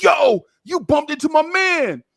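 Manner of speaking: loud, high, drawn-out challenge; confrontational shout. Retold or imitated. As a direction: imitate a dude yelling for his friend. Acts out angry dude's confrontation.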